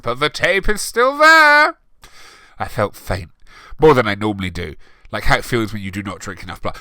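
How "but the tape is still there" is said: Lupin voice. A narrator's reading. Lupin proclaiming.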